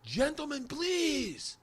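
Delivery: high-pitched woman calling for order; drawn-out falsetto announcement; imitated stage hostess. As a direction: hush the room as the show's hostess. Stage woman silences the crowd, retold.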